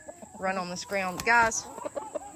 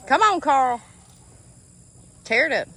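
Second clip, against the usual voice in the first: calling out; 4 semitones higher, 7 dB louder than usual. Real, not acted. Calling ducks out of pen.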